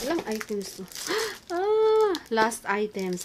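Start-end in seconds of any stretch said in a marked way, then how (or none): 1.5-2.1 high gasp, then loud squeal; excited gasp. Real, not acted. Vlogger discovers the last items, excited gasp.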